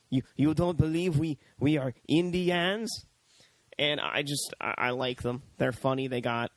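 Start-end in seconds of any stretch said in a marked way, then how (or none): 0.1-2.9 lightly put-on Japanese accent, rising at the end; mock Japanese accent. Samurai Indians answering the miners' question, mock Japanese accent.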